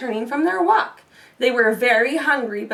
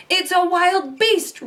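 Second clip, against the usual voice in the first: growling; 6 semitones higher, slower than usual. A narrator's reading. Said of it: "Papa Bear growled".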